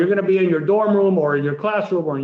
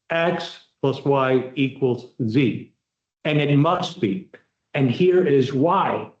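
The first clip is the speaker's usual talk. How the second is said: slow and deliberate, each term set apart; slow direct statement; 4 semitones lower, slower than usual. Shown as a demonstration. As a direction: lay it out plainly, one piece at a time. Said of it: "You see, very simple language".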